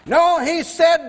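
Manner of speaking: normal talking voice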